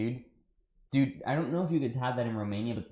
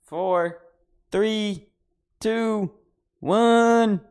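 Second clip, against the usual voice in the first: countdown climbing higher and louder to a yell; excited countdown; 12 semitones higher, 13 dB louder, much slower than usual. Real, not acted. Counting down to the reveal, getting higher and louder to a shout. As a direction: build the hype on each number.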